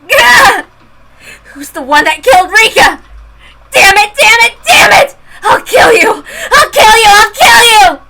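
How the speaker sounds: high furious shouts through tears; crying rage. Acted. Girl threatening the doctors, crying rage. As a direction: scream the threat, losing control. Killing spree, sobbing rage.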